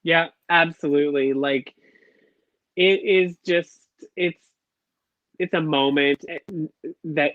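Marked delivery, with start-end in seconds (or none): none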